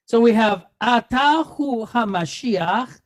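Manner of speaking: ordinary talk, nothing marked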